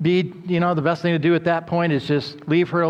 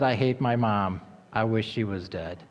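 put-on softer, lower, sober replay of the girl's words; hushed repeat; 7 semitones lower, 6 dB quieter than usual. Shown as a demonstration. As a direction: let the words sink in quietly. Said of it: "Do you think she was really understanding".

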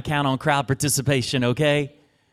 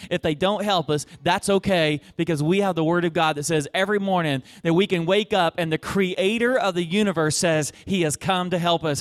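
higher-pitched, driving sermon delivery; building preaching; 4 semitones higher than usual. Real, not acted. Preacher assuring the church that God helps, emphatic. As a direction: preach it, piling up each item.

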